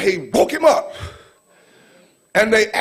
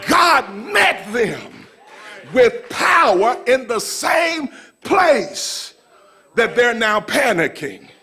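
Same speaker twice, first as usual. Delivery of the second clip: building sermon cry, rising to a higher peak; fervent preacher's proclamation; full-force preaching; 5 semitones higher than usual. Real, not acted. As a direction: preach it up to a climax.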